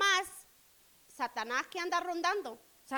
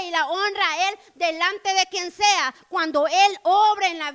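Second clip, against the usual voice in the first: louder, driving preacher voice; building sermon; 7 dB louder than usual. Acted. Preacher praising God's mercy to the congregation, swelling loud.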